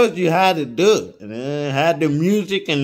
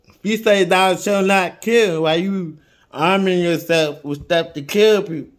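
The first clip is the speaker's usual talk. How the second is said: fiery preaching; 5 semitones higher than usual. Acted. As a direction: preach it hard at the crowd. Preaching against killing.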